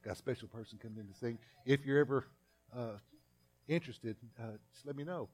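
gentle invitation. Acted. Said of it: "Then Mark comes up three days later and says".

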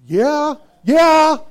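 God's eager yes. Retold or imitated. God answering the believer who says yes, excited yeah. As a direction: cheer them on as a thrilled God would. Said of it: "And I believe the Lord's going".

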